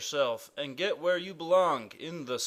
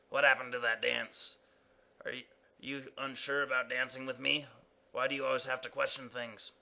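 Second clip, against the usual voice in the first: fast, softer complaint; hurt dancer; 3 semitones lower, 5 dB quieter, faster than usual. Acted. Dancer asks Quint why he always questions things, hurt. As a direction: rattle off a wounded question.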